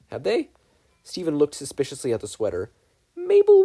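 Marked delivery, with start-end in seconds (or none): 3.1-3.6 much higher and louder name; alarmed call. A narrator's reading. Stephen calling Mabel about moving sweater, very loud. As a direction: call her sharply in alarm.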